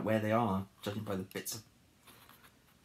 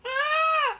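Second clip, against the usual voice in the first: high, loud "Ah!"; frightened scream; 9 dB louder than usual. Acted. Harold sees the eggs hatch, acted scream. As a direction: scream in storybook terror.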